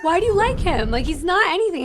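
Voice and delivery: loud, high-pitched retold pleading; exasperated protest. Telling a friend he isn't worth liking, exasperated protest. Retells arguing with her friend.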